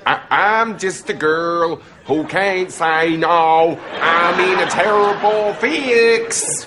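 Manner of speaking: slow southern American drawl that slips towards Welsh; failed southern accent. Shown as a demonstration. Proving he can do it, wobbly southern accent. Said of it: "Can you do a southern American accent?".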